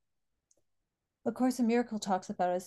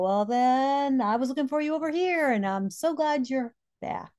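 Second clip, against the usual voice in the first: put-on higher, louder cheerful old dad voice; imitating her dad; 5 semitones higher, 5 dB louder than usual. Dad with Alzheimer's to his daughter, cheerful higher voice. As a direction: do her father's happy greeting, lifted up. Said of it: "And he'd go".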